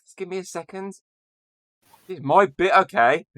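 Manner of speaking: high-pitched defensive protest; playful indignation. Real, not acted. Host defending his bit from interrupting co-hosts, playfully indignant.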